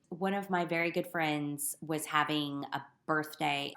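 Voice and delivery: usual speaking voice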